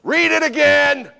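high, loud exasperated yell; exasperated shout. Retold or imitated. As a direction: shout it at him, newspaper in hand. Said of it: "and she would just go".

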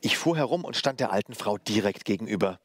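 ordinary delivery, nothing marked

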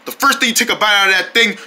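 ordinary delivery

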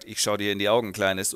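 low, smooth film-hero line; smooth seducer. Acted. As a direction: purr it down low like a movie charmer. Gentleman flirts with Gabi.

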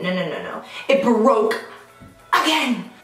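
ordinary talk, nothing marked

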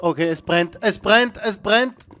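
building to a high, loud yell; panicked shout. Real, not acted. Character on fire in game.

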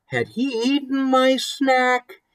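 high, slow suspicious question. A narrator's reading. Girl corners her brother, high and suspicious. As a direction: sound like you caught him.

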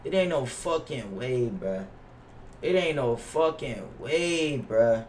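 normal talking voice

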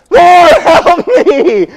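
very slow, high, loud drawn-out cry; audible prayer shout. Retold or imitated. Father crying to God as kids bleed, slow loud shout. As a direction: stretch out a desperate yell to God. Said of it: "they are very audible".

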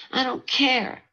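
second I don't care pushed up into a cry; rising anger. Acted. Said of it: "said Frankie angrily".

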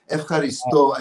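F, haristo with STO accented and held; stress on last syllable. Shown as a demonstration. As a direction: land on the final sto of haristo. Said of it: "the accent's on the last syllable".

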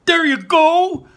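very high, loud slow cheer. Acted. Barley cheering Ian's first step. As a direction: cheer him on with delight. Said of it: "cheered Barley".

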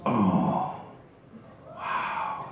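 soft, low "oh, wow" of wonder; hushed awe. Retold or imitated. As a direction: breathe it out in awe. Boy first sees the Viper.

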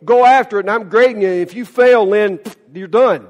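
imitating a stern judge at full volume; mock harsh grader. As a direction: lay down a tough verdict loudly. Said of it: "God is not saying".

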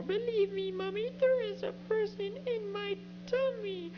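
put-on high begging child voice; pleading child. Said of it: "I tried once more".

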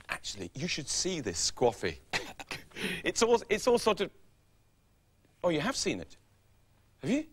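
ordinary talk

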